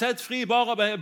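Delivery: high, loud mob demand; crowd shouting. Retold or imitated. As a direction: yell it as an insistent crowd. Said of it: "begynner hele folkemengden å rope".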